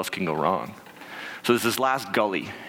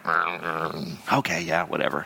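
reluctant whatever; 5 semitones lower, slower than usual. Retold or imitated. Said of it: "I was kinda like".

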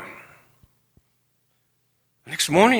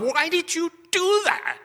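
much higher, slow, disgusted; disgusted self-reproach. Retold or imitated. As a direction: scold yourself in disgust. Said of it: "I was just disgusted with myself".

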